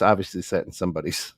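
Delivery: normal talking voice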